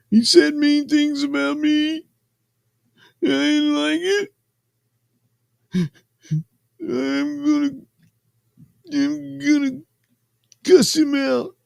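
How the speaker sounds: very high, crybaby voice; mock tearful whine. Answering why he did all this, mock crybaby whine. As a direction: whimper it like a wronged little crybaby, hammed-up. Mimics him whining as excuse.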